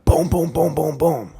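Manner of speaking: loud, high rapid booms; imitated banging. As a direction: mimic pounding that wakes someone up. Imitates banging that woke him.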